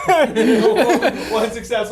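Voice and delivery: high giggling, slow, through laughter; laughing. Real, not acted. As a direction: laugh out the result in disbelief. Player laughs at dice roll.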